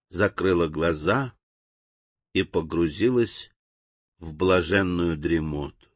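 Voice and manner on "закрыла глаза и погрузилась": plain reading voice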